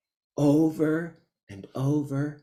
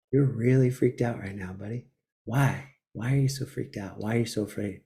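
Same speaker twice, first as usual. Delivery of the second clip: softer, gentle inner voice calming himself; tender self-talk; 4 semitones lower, 5 dB quieter than usual. Pretend. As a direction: soothe yourself like a kind friend. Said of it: "be like".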